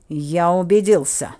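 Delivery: loud, slow growling reply; growled. A narrator's reading. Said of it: "прорычал Синеглазый".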